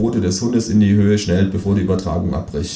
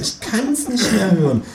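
high, slow exasperated kangaroo voice; fed-up kangaroo; 13 semitones higher than usual. Acted. Kangaroo at home sick of the talk, high slow weary voice. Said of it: "sagt das Känguru zu Hause".

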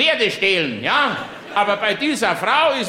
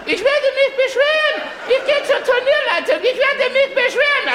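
much higher, shrill mock complaint through laughter; shrieking indignant mother; 14 semitones higher than usual. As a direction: squeal the threat to complain like an outraged lady. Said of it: "Dann fängt sie an". (hohe, empörte Dame, kreischend parodiert)

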